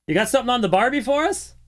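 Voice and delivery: the same joke repeated in a high, fast mock Australian accent; a repeated cheesy Australian impression; bad Australian accent. Host repeats his joke to a caller who did not catch it, in a bad Australian accent. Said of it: "bad Australian accent".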